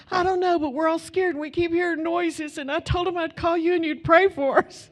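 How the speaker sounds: imitating a terrified boyfriend; scared boyfriend. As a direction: beg for help, spooked.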